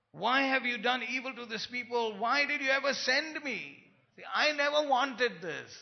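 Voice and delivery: aggrieved Moses protesting to the Lord; whining Moses. Acted. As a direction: whine it out like a reluctant leader. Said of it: "Arrogance. Whining".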